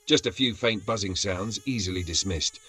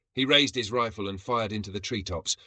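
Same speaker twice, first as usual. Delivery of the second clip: ordinary delivery, nothing marked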